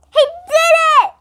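high, loud joyful shout; excited shout. A narrator's reading. Classmate celebrating Stephen's gong, loud shout. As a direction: shout with triumph. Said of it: "shouted Mac".

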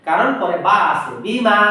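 ordinary delivery

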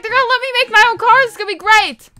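quick, high, hopeful gush; retold excitement. Gamer mocking her hope of building a car, excited. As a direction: imitate your own short-lived delight, starting fast and high. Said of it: "I thought I was gonna be able".

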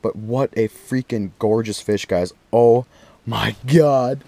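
ordinary talk

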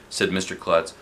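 usual speaking voice

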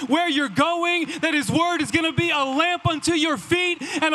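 rising, loud preacher's build; fervent youth-pastor preaching. Acted. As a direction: drive it home to the room, building. Preaching at full force to youth.

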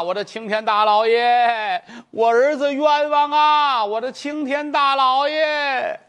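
high, slow drawn-out wail; hammed-up lament. Mother wails her son's innocence at the magistrate, slow and high. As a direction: stretch every word into a wail.

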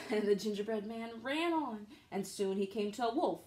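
ordinary delivery, nothing marked

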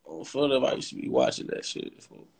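ordinary talk, nothing marked

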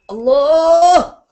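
ringing drawn-out call of Allah; long calling cry. Acted. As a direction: call Allah out loud and high, held long. Passerby calls out God's name.

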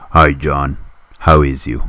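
ordinary talk, nothing marked

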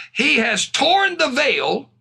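high, slow proclamation; preached scripture reading. Acted. Reads psalm with fervour.

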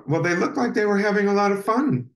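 high-pitched, quick mom quote; retold mom. Mom answering her child about the ghost game, retold mom voice. Mom's kindly reply in the story.

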